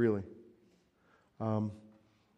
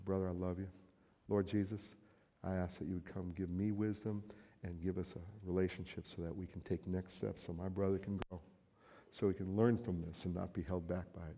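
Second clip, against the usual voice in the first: soft prayer over a brother; 4 semitones lower, 7 dB quieter than usual. Pretend.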